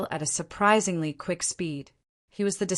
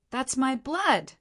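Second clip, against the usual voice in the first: restrained angry cry, high and slow; indignant protest, pitched up and drawn out; angry shout; 7 semitones higher, slower than usual. A narrator's reading. Stone Emperor to Ling Han who wants his blood, angry shout. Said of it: "scrunched up in anger, and he shouted".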